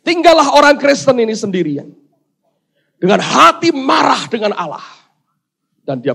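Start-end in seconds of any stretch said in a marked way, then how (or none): 0.0-4.8 high, loud emphatic narration; dramatic preaching. A narrator's reading. Preacher telling congregation of the man left alone, forceful narration. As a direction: proclaim the scene forcefully.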